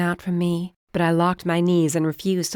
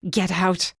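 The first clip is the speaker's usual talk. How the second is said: through gritted teeth; 2 semitones higher than usual. A narrator's reading. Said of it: "I uttered through my teeth".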